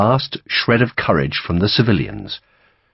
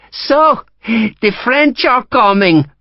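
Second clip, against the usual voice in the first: high, slow, cackling taunt; taunting old woman; 4 dB louder, much slower than usual. A narrator's reading. The Marquesa taunts Sharp, high slow mocking crone voice. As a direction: sing it out to mock him. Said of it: "she taunted him".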